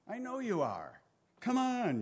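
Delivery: high-pitched coaxing appeal; pleading. Acted. Job appealing to God's mercy, pleading. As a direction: plead with rising exasperation, pitch lifted.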